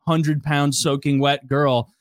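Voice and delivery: ordinary talk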